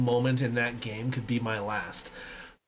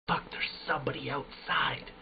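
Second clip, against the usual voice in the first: hushed, much softer whisper to a teammate; retold whisper; 7 dB quieter than usual. Warning his teammate over Discord about an enemy, hushed whisper. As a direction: hiss it so the enemy can't hear. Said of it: "We're whispering in Discord".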